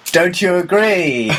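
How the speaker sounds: high, slow theatrical question; pointed stage challenge. Retold or imitated. Actor challenging the one whose line was nicked, theatrical challenge. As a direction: drawl it out at a rival, hammed up. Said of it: "I actually went".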